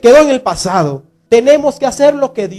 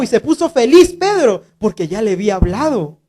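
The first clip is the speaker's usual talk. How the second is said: usual speaking voice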